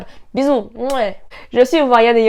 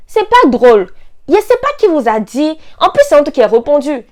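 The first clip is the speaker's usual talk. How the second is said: loud, high indignant protest; hammed-up, raised-voice exasperation; mock outrage; 6 semitones higher, 9 dB louder than usual. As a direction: protest loudly as if exasperated by a bad joke. Mock outrage at a tired joke.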